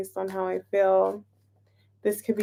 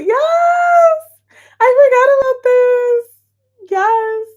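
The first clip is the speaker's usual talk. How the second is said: very high, loud delighted squeal; shrieking excited yes; thrilled yes; 9 dB louder, much slower than usual. Real, not acted. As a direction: squeal with joy at the surprise. Thrilled at discovering feature.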